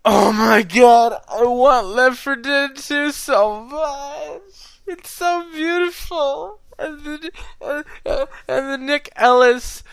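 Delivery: high, loud, slow fangirl squeal; excited gushing. Real, not acted. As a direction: squeal with longing. Gushing over a game.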